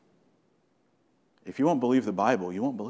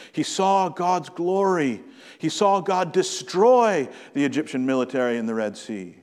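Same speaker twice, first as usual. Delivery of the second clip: raised, impassioned sermon voice, pitch lifted high; fervent preaching; 5 semitones higher, 3 dB louder than usual. Acted. Pastor stirring the congregation about Moses, fervent preaching. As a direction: preach it with mounting fire.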